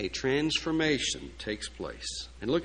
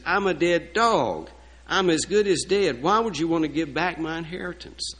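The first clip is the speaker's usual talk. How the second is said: loud, higher self-abasing protest; acted disbelief; 5 semitones higher, 6 dB louder than usual. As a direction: plead unworthiness loudly, playing the humbled servant. Acted humbled man protesting to king.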